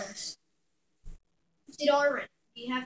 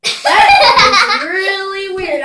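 laughing; 6 semitones higher, 15 dB louder, slower than usual. Real, not acted. Laughing after the burp.

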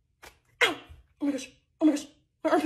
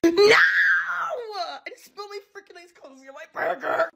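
fast, half-crying complaint; distraught whimper; real near-tears whine; whiny dismay; 4 semitones higher, faster than usual. Real, not acted. Spilled coffee on burger.

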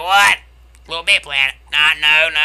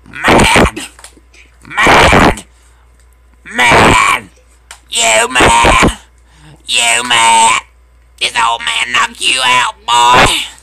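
very loud, punchy jeering; shouted taunt; 7 semitones higher, 9 dB louder, much slower than usual. Real, not acted. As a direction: shout it in his face to rub it in. Shouted taunts at angry player.

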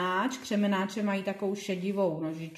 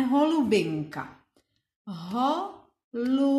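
"holubinka" split ho-lu-bin-ka, one clap each; clapped syllables; 3 semitones higher than usual. Shown as a demonstration. Teacher clapping out "holubinka" with the children. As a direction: clap it out in beats. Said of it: "zatleskáme".

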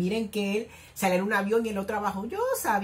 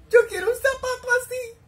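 high, giggly fangirl squeal through a laugh; giddy squeal; 4 dB louder than usual. Real, not acted. Fan squealing over a cute outfit, giggling high.

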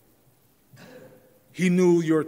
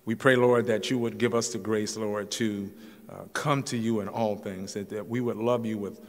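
lower, earnest prayer voice; closing prayer; 3 semitones lower than usual. Acted. Pastor leads the congregation in prayer, earnest and low. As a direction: pray sincerely for the church.

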